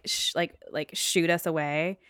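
normal talking voice

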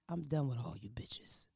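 low hushed whisper, slowed down; whispered threat. Acted. Host whispering a mock threat to her guests. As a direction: hiss the threat under your breath.

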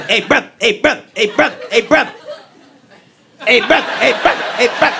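high sing-song panhandler call; homeless man asking for change. Retold or imitated. Homeless man calling to everyone, sing-song hey brother. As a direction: repeat it like a street hustler calling out. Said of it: "He was like".

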